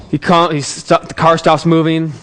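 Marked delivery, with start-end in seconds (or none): none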